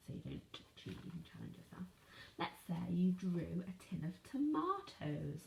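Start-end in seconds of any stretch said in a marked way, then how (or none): 0.1-1.9 much softer, under the breath; whispered aside. Real, not acted. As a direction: slip it in quietly. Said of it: "teacher muttering aside".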